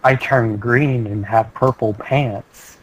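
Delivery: very slow, flat monotone voice. Shown as a demonstration. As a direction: drag the words out evenly. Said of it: "in my slow, monotone voice".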